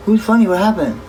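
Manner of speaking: high, loud, startled question; surprised. Real, not acted.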